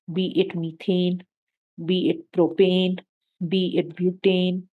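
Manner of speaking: slow listing. Shown as a demonstration. Listing alkanes ending in e.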